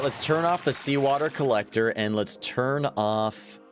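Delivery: usual speaking voice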